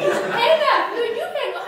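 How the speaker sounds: high, hammed-up lovers' protest; lovesick caller. Couple on a midnight phone call, mock lovesick pleading. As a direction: coo it high, playfully refusing to end the call. Acted couple refusing to hang up.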